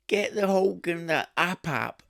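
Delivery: high, loud, drawn-out Cockney parody; mock Cockney. London labourer asking the plasterer for tools, mock Cockney. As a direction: call it out slow and high in Cockney. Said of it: "the other thing he used to say".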